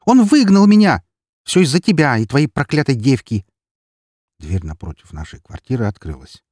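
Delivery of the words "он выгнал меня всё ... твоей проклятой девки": loud, high-pitched accusation; furious yell. A narrator's reading. Эри blames the narrator for being thrown out, yelling furiously. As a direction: start at a scream, easing slightly into bitter blame. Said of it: "в бешенстве заорала она".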